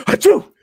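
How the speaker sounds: high, loud mock sneeze; loud fake sneeze. Stocking eggs at work, high loud mock sneeze. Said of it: "and I'll go".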